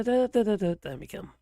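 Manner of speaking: usual speaking voice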